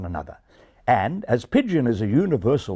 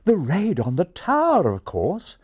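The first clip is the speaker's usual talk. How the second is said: put-on refined English accent; 9 semitones higher than usual. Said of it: "replied the English pigeon".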